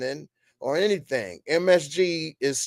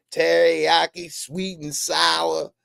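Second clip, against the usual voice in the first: ordinary talk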